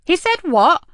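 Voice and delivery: shocked, demanding it again; exclaiming in surprise. Acted. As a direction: boss hearing shocking news on the phone. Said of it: "she exclaimed".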